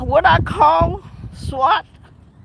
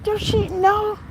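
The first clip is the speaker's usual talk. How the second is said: higher mock disbelief; what is she doing, higher and amazed; incredulous; 7 semitones higher than usual. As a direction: react as if you can't believe it. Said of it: "I was like".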